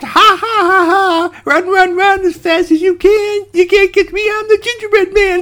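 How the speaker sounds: very high, loud taunting cackle and chant; laughing gingerbread man. Acted. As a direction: squeal the taunt with mad glee. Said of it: "and he just laughed".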